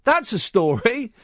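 high-pitched mock amazement, drawn out at the end; astonished reaction. Host retelling his fright at a headline, amazed. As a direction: replay your startled double-take. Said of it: "I was thinking".

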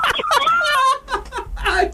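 high, soft embarrassed laughter. Real, not acted. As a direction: laugh it off, mortified. Mortified after fleeing shop.

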